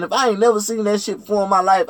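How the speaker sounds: ordinary delivery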